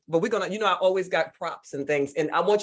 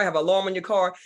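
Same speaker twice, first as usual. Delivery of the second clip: normal talking voice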